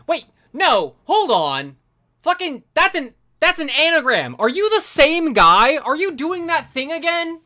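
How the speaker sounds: wait, no, it clicks, raising the voice; excited realisation. Real, not acted.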